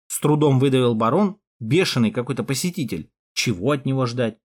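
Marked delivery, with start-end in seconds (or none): none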